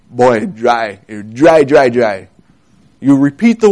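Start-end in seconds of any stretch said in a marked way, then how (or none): 0.1-2.3 low-pitched Belize Creole phrasing; lightly imitated Belize Creole. Shown as a demonstration. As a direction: repeat "dry" for emphasis, as in Belize Creole. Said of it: "the Creole in Belize".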